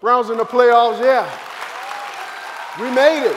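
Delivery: loud, elated cheer; celebrating shout. Acted. Player celebrating the playoff berth, loud cheering. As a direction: whoop it out to a roaring crowd.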